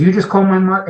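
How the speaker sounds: can't believe what he hears; incredulous friend. Retold or imitated. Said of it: "He goes".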